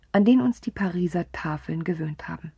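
plain reading voice

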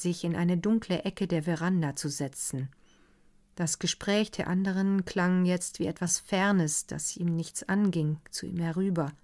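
plain reading voice

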